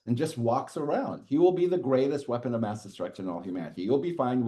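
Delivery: ordinary talk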